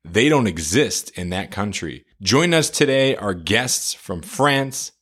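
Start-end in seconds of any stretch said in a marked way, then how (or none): none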